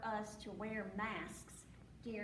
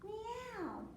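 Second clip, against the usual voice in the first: high, falling cat's meow; 10 semitones higher than usual. A narrator's reading. Storyteller showing what the rabbit caught, high meowing. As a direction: meow like a cat. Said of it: "he caught the".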